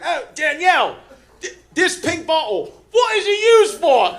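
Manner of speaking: high, loud mock call across the room; hammed-up agent calling out to a colleague; calling to coworker. Agent calls to coworker twenty feet away, high and loud. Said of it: "He was like".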